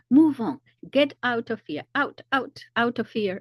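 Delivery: high, loud mock soldier barking orders; authoritarian order. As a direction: imitate a soldier ordering people off. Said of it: "in this very authoritarian manner".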